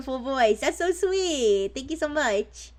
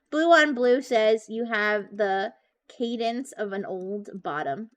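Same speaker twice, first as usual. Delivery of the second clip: slow dictating; 3 semitones lower, slower than usual. Real, not acted. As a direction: spell out the message slowly for the bot. Relays chat's insult to Doctor Fish.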